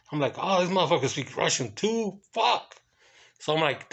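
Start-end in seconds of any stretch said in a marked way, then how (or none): 0.4-2.7 high-pitched retold disbelief; mock astonishment. Guard finding the guest knows another language, astonished. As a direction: replay your stunned reaction, pitch climbing on the curse.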